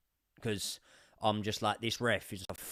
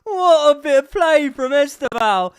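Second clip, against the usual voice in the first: high, loud, slow raving; ecstatic shout; 12 semitones higher, 12 dB louder than usual. Real, not acted. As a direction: shout the praise with delight. Raving about the goal.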